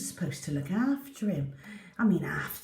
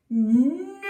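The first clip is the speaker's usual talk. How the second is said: high, loud outcry; shocked cry; 9 semitones higher, 6 dB louder than usual. Acted. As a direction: cry out in dismay. Poppy sees George with bone.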